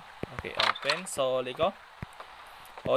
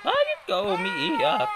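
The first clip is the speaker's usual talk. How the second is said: much higher, slow cooing question; high slow baby talk; much slower than usual. Pretend. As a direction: coo at the crying baby. Asks the crying baby why.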